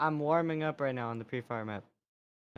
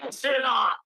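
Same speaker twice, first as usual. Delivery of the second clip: angry shout; 12 semitones higher than usual. Real, not acted. Annoyed by echo feedback.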